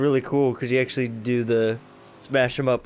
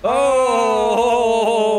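excited shout; 8 semitones higher, 7 dB louder than usual. Real, not acted. Excited win.

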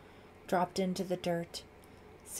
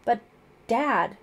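higher, louder plea; pleading; 5 semitones higher, 10 dB louder than usual. A narrator's reading. Daughter objects to her father's order, pleading. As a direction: protest to a parent, pleading. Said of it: "Sarah pleaded".

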